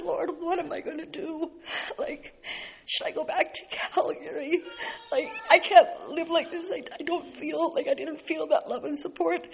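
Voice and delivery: crying out through real tears; a broken, tearful prayer with a laugh through it; tearful plea. Real, not acted. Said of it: "which I'm doing now".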